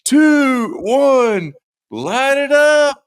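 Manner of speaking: high, loud slow countdown call; mock countdown shout. MC counting down the tree lighting, shouted. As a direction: call it out like an MC to the crowd. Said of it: "he goes".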